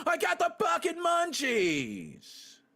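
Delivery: drawn-out munchies yell. Real, not acted. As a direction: stretch it out and shout the craving. Loud complaint about hunger.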